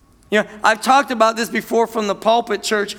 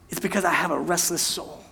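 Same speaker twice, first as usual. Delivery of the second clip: quietly confessing; 4 semitones lower, 8 dB quieter than usual. Acted.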